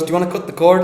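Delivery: high, loud midwife voice; retold loud question. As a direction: call it out to someone dazed. Retold midwife calling to dazed man.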